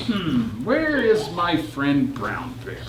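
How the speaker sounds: low, wondering hmm; puzzled searching. A narrator's reading. Teacher voicing Polar Bear hunting for his friend, low and puzzled. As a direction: wonder aloud where he went.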